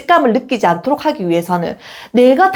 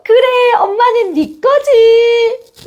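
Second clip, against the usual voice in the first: much higher, slow, sweet and coaxing; reassuring mom; 12 semitones higher, 3 dB louder, much slower than usual. Shown as a demonstration. Mother reassuring possessive child, sweet and slow. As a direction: lovingly give in to the child. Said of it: "어떻게 말해야 하냐면".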